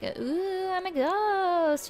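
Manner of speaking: high, slow, wailing ghost moan; haunt him with a hammed-up ooh; spooky ghost voice. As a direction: ghost trying to scare the writer, mock spooky wail. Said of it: "upping the ante on my haunting".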